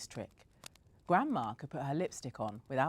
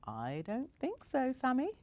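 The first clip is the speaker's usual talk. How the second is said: put-on giggling old lady; 10 semitones higher than usual. A narrator's reading. Granigan refusing to go in, lightly voiced giggling tease. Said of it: "she giggled".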